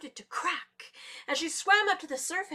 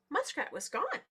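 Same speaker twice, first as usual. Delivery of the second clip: usual speaking voice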